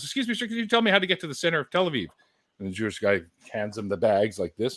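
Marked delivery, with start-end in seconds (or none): 0.0-2.1 high, fast polite tourist voice; tourist asking directions. Retold or imitated. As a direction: rush the question up high. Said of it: "He goes".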